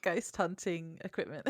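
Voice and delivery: ordinary talk, nothing marked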